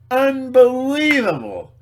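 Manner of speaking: loud, slow cry of disbelief; loud slow exasperated unbelievable; exasperated outburst. Real, not acted. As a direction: draw it out high and loud in outrage. Ranting about suffering.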